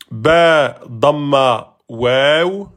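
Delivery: usual speaking voice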